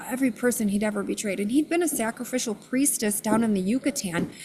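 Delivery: usual speaking voice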